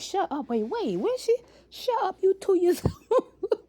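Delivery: high, loud mock outrage breaking into laughter; incredulous laughing mom. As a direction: act shocked a toddler said it. Mom retorting to toddler, laughing.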